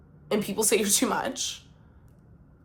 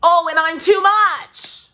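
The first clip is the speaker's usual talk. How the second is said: sarcastic jab; 9 semitones higher, 7 dB louder, slower than usual. Real, not acted. Sarcastic jab at the other person.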